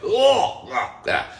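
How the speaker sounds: loud, high "oh" falling away; mock-agonized wail; anguished groan. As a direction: groan out a retold misery. Imitates his reaction to hives.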